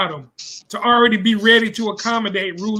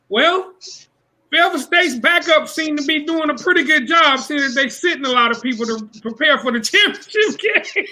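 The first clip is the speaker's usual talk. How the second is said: higher-pitched mimicked sportscaster; announcer; 7 semitones higher than usual. Retelling the game broadcast, high announcer voice. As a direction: imitate a chatty announcer, breaking into a laugh at the end. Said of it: "I heard the announcer say".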